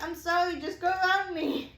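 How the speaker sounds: high, loud, apologetic wail, re-enacted; retold hysterical. Stranded driver apologizing to honking cars, retold hysterical. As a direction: mock-desperate pleading with drivers. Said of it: "hysterical. I'm, like, freaking out".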